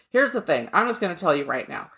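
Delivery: ordinary delivery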